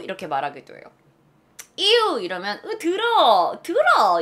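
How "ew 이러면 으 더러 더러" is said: high, loud, whiny disgust; exaggerated "ew, dirty"; grossed out. Shown as a demonstration. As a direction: squeal it in revulsion. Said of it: "이런 말이에요".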